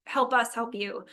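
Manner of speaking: usual speaking voice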